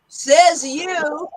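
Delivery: skeptical retort. Shown as a demonstration. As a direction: fire it back in disbelief. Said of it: "so we're gonna try this".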